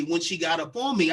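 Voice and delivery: normal talking voice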